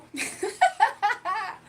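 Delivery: loud, high burst of laughter; real laugh. Real, not acted. Streamer cracking up while addressing chat, loud high laugh.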